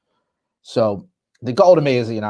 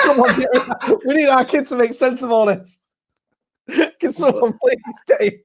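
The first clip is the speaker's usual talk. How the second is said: much higher, hammed-up urgent plea; mock Danish newsroom; 12 semitones higher than usual. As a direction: play a frantic newsroom calling for help. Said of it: "and they're like".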